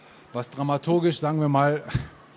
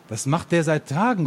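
higher-pitched narrated call; mildly raised challenge from a bystander; 5 semitones higher, 4 dB louder than usual. A narrator's reading. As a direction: call it across to the others. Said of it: "ruft ein anderer Mann".

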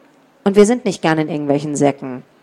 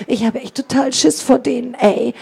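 scared hissing; 7 semitones higher than usual. A narrator's reading. Carlos to his gang about the pursuers, scared hissing. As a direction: hiss it urgently to your friends. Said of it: "Und Carlos zischte".

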